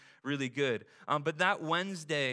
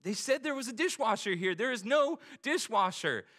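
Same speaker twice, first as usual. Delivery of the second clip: higher, louder mock panic; freaking out; 8 semitones higher, 3 dB louder than usual. Husband panicking to his wife about the dishwasher, mock panic. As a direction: let the panic rise. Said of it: "And I start to freak out".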